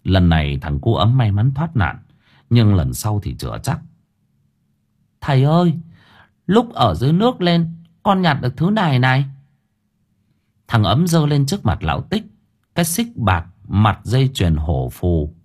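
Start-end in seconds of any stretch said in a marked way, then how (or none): 5.2-9.2 put-on higher boy's voice, eager; a boy proudly showing a find; higher-voiced boy showing his father a chain; young boy. A narrator's reading.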